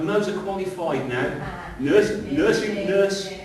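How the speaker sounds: loud, high, slow and drawn out; building excited proclamation; proud proclamation. Real, not acted. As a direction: celebrate her to the church. Proud announcement of Mo.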